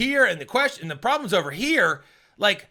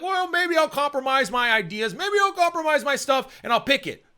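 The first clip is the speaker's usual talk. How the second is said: high-pitched imitation of caving in; mock a student talking themselves round, pitched up; wavering student; 8 semitones higher than usual. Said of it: "bad answers make their case, and you're like".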